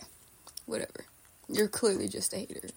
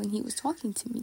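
usual speaking voice

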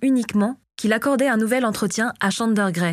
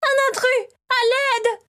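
shrill-free but much higher desperate shout; terrified scream for help; slower than usual. A narrator's reading. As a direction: scream it as if a stranger is in the room. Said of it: "cria-t-elle".

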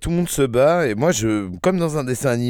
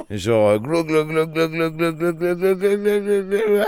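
glou glou rising higher and higher as if downing a bottle; gulping sounds; 6 semitones higher, slower than usual. Retold or imitated. He mimics chugging vodka in one go, rising glug sounds. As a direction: chug noises climbing in pitch. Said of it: "je vide la bouteille, mais d'un trait".

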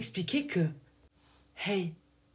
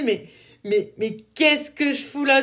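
higher, louder mock outburst; exasperated exclamation; 5 semitones higher, 5 dB louder than usual. Telling listeners about feeling lost, a higher, louder mock outburst. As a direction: throw up your hands at yourself. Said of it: "ce moment où tu te dis".

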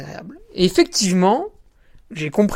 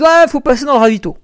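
raised, louder bossy volunteer; volunteer telling the runner to go through the aid station, higher and louder; volunteer's brusque order; 8 semitones higher, 7 dB louder than usual. Retold or imitated. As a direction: mimic the volunteer barking an order. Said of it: "les bénévoles me disent".

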